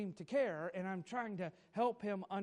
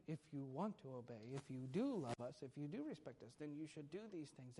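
manipulative; 4 semitones lower, 9 dB quieter than usual. Retold or imitated. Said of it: "We manipulate them".

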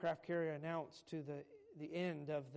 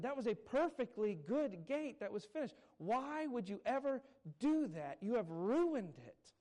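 high, loud, slowing indignant outcry; upset protest; 7 semitones higher, 7 dB louder than usual. Retold or imitated. Carpenter protesting to the farmer who destroyed his gate, high and loud. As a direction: plead in outrage over the wrecked gate.

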